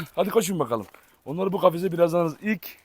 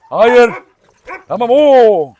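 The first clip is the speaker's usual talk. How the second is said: loud, high commanding no; loud scolding command to a dog; stern shout; 4 semitones higher, 11 dB louder, much slower than usual. Real, not acted. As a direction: order the dog to stop. Scolding dogs in scuffle.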